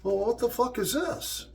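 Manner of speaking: high imitated shocked question; shocked outburst. Brother-in-law grabbing the medical bag, imitated high shocked outburst. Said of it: "and he's like".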